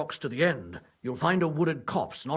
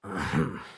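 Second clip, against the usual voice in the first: short low chuckle; 9 semitones lower than usual. Acted. Stablemaster relieved, chuckles.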